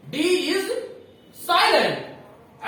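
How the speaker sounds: "D is" then a pause before "silent"; slow, spaced rule. Shown as a demonstration. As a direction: drill it word by word. (रुक-रुक कर नियम बोलना)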